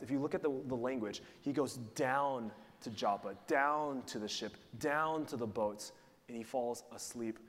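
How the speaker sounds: usual speaking voice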